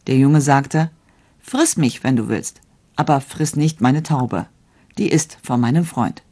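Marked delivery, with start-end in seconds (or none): none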